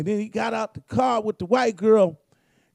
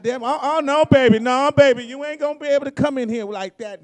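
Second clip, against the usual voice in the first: retold grandma scolding; 5 semitones higher, 7 dB louder than usual. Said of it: "She said".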